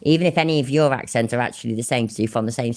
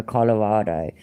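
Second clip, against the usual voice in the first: ordinary talk, nothing marked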